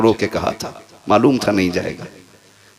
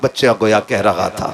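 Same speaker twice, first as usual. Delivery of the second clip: ordinary delivery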